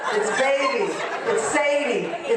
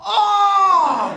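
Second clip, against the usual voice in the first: loud high swooning "Oh!"; rapturous oh; 12 semitones higher, 10 dB louder than usual. Retold or imitated. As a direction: groan with pure pleasure. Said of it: "there is a bad ah".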